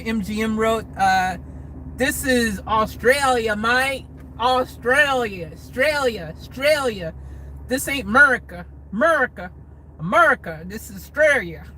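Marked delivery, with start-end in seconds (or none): 2.0-11.6 put-on a joking, lightly done Aussie accent, repeating Australia with falls; bad Australian accent. Vlogger mocks a viewer's question in a bad Australian accent. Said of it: "That was bad accents".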